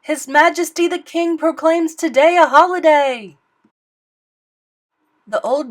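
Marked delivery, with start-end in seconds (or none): 0.0-3.4 loud, high announcement; proclamation. Acted. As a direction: proclaim it like a herald. Announcing holiday to bell ringer.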